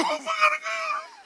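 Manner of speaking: high laughter through the words; laughing hard. Real, not acted. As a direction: laugh while telling it. Pastor laughs retelling call.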